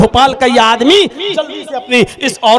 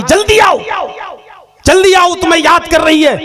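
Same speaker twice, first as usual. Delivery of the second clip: urgent call; 7 semitones higher than usual. Acted. Doctor urgently calls husband.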